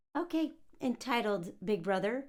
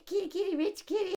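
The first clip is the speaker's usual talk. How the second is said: higher coaxing call; sing-song cat call; 8 semitones higher than usual. Retold or imitated. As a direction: coax the kitty. Calling the cat.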